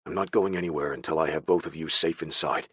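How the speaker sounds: lightly voiced protective man; deep, firm man. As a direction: drop low, allow no argument. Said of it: "His deep voice allowed no argument".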